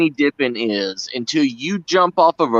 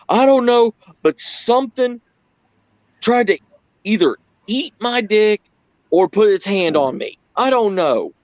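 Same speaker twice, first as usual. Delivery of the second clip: mock rattled Brad; 8 semitones higher than usual. Brad explains what grabbed him.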